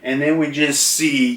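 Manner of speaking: normal talking voice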